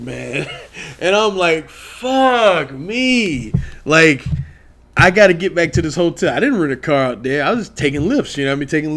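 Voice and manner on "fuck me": high, exasperated curse under the breath; exasperated groan. Retold or imitated. Fan stranded outside the arena with a dead phone, exasperated. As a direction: let it out like your night just collapsed.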